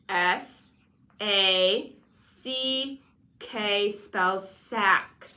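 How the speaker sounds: letters spelled very slowly, one by one; slow spelling out. Shown as a demonstration. Teacher to pupil spelling sack slowly. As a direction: drag each letter out for the child.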